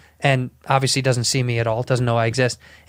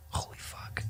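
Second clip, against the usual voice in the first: stunned whisper; 19 dB quieter, slower than usual. Retold or imitated. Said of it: "And I go".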